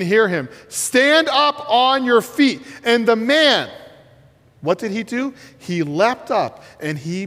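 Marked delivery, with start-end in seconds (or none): 0.7-2.6 raised, slow command; commanding call; loud command. Acted. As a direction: proclaim it to the whole courtyard. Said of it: "So he said in a loud voice".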